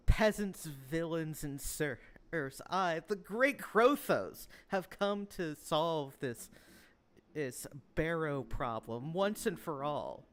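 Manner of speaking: loud, slow, grandiose public proclamation; Krothos. Acted. Game master as pompous lord addressing villagers, grand proclamation. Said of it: "a speech, the gist of which".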